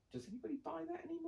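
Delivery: high-pitched, quick, scoffing question; mock incredulous customer. Mimicking a customer who thinks hi-fi is dead, high and fast. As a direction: squeak it out like a baffled shopper. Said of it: "the other one is you say hi-fi".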